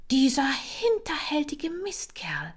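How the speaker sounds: hissed, high, outraged whisper. Acted. A hamster whispering in shock at the thief.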